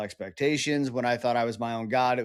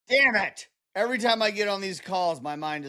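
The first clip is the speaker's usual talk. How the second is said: blown away; 5 semitones higher than usual. Real, not acted. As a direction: exclaim it in real astonishment. Host reacts, mind blown.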